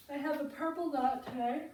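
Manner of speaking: talking through a yawn. Real, not acted. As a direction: yawn while saying it. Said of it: "I'm yawning on top of it".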